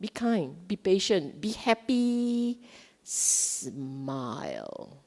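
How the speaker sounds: soft, calming mantra; hushed, soothing self-encouragement; gentle self-talk. Shown as a demonstration. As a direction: coax yourself softly, stretching the last words. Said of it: "this is how you talk to yourself".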